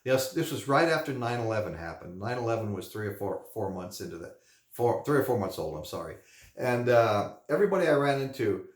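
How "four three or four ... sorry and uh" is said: normal talking voice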